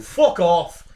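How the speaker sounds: mock Irish accent. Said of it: "It's very Finn".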